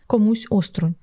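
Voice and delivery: usual speaking voice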